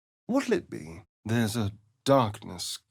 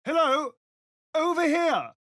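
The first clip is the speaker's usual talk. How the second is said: calling out. Acted. Calling out to someone.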